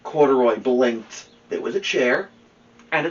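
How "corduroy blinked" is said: ordinary delivery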